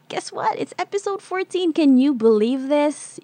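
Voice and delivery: spring the news on them like a surprise gift; host thrilled to tell listeners it's episode fourteen; excited announcement. Real, not acted.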